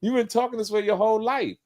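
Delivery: high, fast mock disbelief; high, rapid teasing from a high school buddy. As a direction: squeak it out like a stunned old friend. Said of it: "I said".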